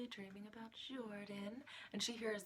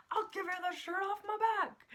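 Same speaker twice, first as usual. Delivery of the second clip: much higher and louder hammed-up salesman pitch; cheesy car commercial; 13 semitones higher, 9 dB louder than usual. Joey hamming his car commercial, loud high salesman voice. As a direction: sell it like a corny TV ad man. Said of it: "Joey's car commercial".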